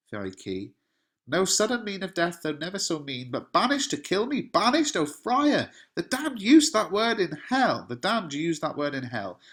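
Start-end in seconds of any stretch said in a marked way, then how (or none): 1.3-5.7 much higher, louder, rising wail; over-the-top despair. Acted. Romeo's over-the-top dramatic reaction to banished, wailing high. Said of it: "over-the-top dramatic reaction from Romeo". 5.9-7.8 dramatic cry. Acted. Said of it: "over-the-top dramatic reaction from Romeo".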